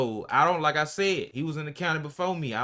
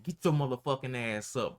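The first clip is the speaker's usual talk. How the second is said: ordinary delivery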